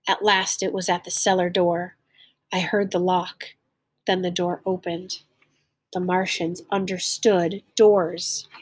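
clear, lively read-aloud storytelling; story narration. A narrator's reading. Teacher reading a story aloud to learners, lively storytelling. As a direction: read the tale to the class with feeling.

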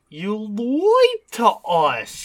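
very slow, stretching every word; long, dramatic mock accusation; drawn-out mock accusation. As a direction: drag it out like a betrayed child.

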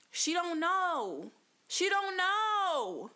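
KNOW drawn out, high and falling; emphatic drawl. Real, not acted. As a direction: stretch "know" slowly, pitched well up. Stressing mother's ignorance, drawn out.

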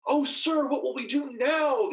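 put-on high, panicked plea from a young servant; frightened cry. A narrator's reading. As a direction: cry out to your master in alarm. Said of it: "the young man cried".

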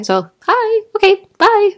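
high, loud sing-out hello and goodbye; awkward cheery greeting. Acted. Greeting a stranger then leaving at once, awkwardly chirpy. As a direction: chirp it brightly, then bail out.

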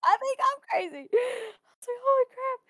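very high squeal through laughter; hysterical, amused retelling of panic; laughing freak-out. Retold or imitated. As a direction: squeak it out, cracking up. Retold freak-out, breaking into laughter.

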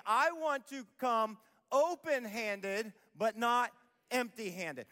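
slow, emphatic call to repeat; slow group recitation. Shown as a demonstration. Pastor leading congregation in a slow, emphatic unison refrain. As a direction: lead the crowd through each word. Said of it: "Say it, say it with me".

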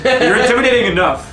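high laughing reply. Real, not acted. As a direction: laugh through the words.

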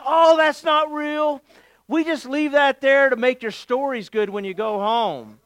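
high, loud put-on dude voice; hipster voice. Guide explaining the fake gator, in a hipster voice. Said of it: "he says in his hipster voice".

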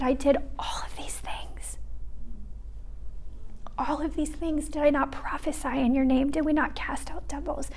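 whispered, desperate protest; hushed pleading. Retold or imitated. Voicing the many pleading with Jesus.

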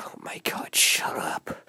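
whispered, put-upon reply; annoyed whisper. Retold or imitated. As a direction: whisper it, fed up. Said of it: "he's gonna be like".

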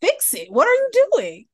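high, loud panicked urging; urgent alarm. Retold or imitated. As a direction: push them to act now. Urging dad to fix choking.

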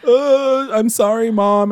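high, whiny mock apology; high-pitched sheepish apology; apologetic daughter. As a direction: imitate a teen pleading sorry to her mother. Voices Betty apologising to mother.

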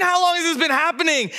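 high, incredulous echo of a question; frantic dad. Retold or imitated. Dad repeating Jesus' question back at him, frantic disbelief. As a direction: throw the question back in high-pitched desperation. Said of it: "This dad's like".

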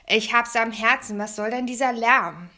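loud grumpy complaint; booming, irate neighbour; blustering. A narrator's reading. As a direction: rant indignantly at the noisemakers. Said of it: "poltert er los".

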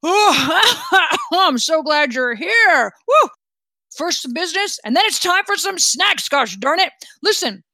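high, laughing, out-of-puff royal welcome; jovial, puffing king. Acted. King greets the crew after sinking into his chair, jovial and puffing. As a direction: bubble over with delight between puffs.

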